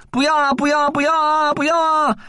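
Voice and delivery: high, desperate begging; terrified kid crying no; frantic pleading. Acted.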